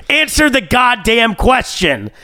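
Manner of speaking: high, slow, stressed "Answer the goddamn question"; all caps demand. Retold or imitated. Rereading his text to the scammer, a slow caps demand. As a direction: hit every word like capital letters. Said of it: "I said".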